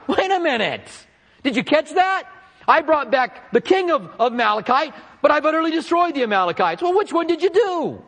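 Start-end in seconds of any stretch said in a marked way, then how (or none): 2.7-6.8 higher mock-whiny Saul; whining. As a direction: whine the contradiction. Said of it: "hear him whining".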